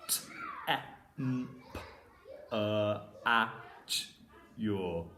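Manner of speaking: each sound said apart. Shown as a demonstration. Teacher sounding out the word for pupils. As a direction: sound out T, e, m, p, e, a, ch, yor one by one. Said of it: "I'll go through it slowly".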